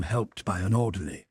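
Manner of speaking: ordinary delivery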